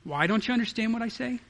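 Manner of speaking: sitting back, mildly curious; matter-of-fact. Shown as a demonstration. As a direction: ask it simply, with no accusation. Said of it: "Just, it's matter of fact".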